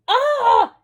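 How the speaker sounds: high loud shriek of fright; frightened scream. A narrator's reading. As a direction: scream in terror. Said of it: "yelled Granny".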